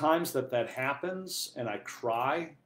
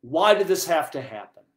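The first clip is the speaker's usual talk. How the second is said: raised, louder cry of protest; retold anger; 5 semitones higher, 10 dB louder than usual. Priest reliving his anger at a death, angry protest. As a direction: demand an answer, reliving the anger. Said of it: "anger started to come up inside of me".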